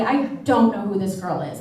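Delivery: normal talking voice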